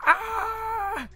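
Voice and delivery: high sharp mock cry of pain; pretend scream. Pretending money burns the legs, sharp mock yelp. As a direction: yelp as if burned.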